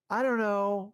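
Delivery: whiny spouse. Acted. Spouse dodging the dinner question.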